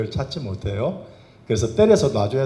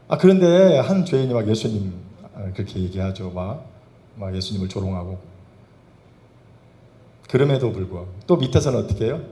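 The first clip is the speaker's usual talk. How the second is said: ordinary talk, nothing marked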